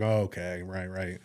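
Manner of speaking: retold wary agreement, trailing down quietly; skeptical playing-along. Acts out his growing suspicion.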